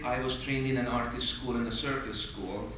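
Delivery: normal talking voice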